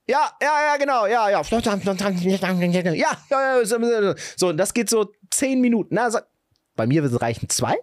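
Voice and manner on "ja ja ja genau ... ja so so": high, jabbering phone chatter. Acted. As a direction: parody someone babbling on a video call. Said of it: "der laut FaceTimet".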